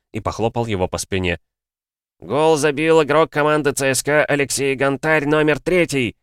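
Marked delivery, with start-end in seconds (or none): 2.2-6.1 football commentator. Acted. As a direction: announce the goal like a TV commentator. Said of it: "С интонациями футбольного комментатора".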